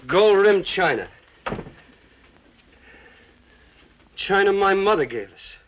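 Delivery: bitter rage. Acted. Drunk husband sneering at his wife over the china, bitter. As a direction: spit each word out with contempt.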